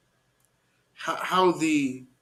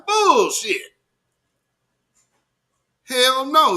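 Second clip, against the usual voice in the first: loud scoffing bullshit, pitch jumping higher; emphatic rejection; 8 semitones higher, 5 dB louder, slower than usual. Real, not acted. Host scoffing at viewers' claim, loud and dismissive. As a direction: shout it down, outright disbelief.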